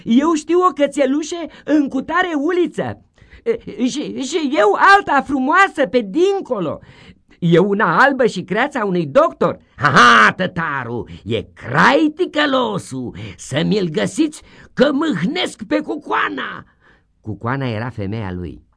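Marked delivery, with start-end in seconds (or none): 0.0-9.6 put-on high, loud kids volunteering; eager children. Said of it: "se sculau câte zece să spuie". 9.8-16.7 high, loud, triumphant teacher crowing; loud gloating schoolmaster; gleeful schoolmaster shout. Acted. Schoolmaster orders.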